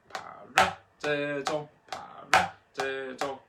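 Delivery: paradiddle with RA punched; slow chant, popping the second syllable "ra"; accent on second syllable. Shown as a demonstration. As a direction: hit the RA in each paradiddle. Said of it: "two, and three".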